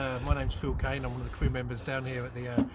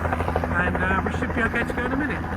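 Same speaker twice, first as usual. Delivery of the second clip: raised voice over engine noise; yelling over helicopter; 5 semitones higher, 4 dB louder than usual. Real, not acted. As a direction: call out above the noise. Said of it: "Excuse the helicopter behind".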